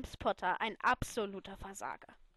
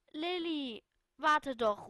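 dismayed voice; 4 semitones higher, 4 dB louder, much slower than usual. Acted. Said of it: "mit betroffener Stimme".

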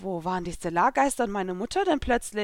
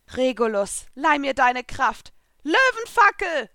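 building to a high, loud slow cry; shout; 6 semitones higher, 6 dB louder, slower than usual. A narrator's reading. Lucy summoning Regulus's power, shouting the attack. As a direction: call on the spirit, rising into a battle shout. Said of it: "schrie ich".

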